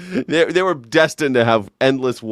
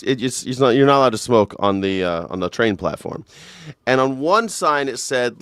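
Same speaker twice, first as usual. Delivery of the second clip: ordinary delivery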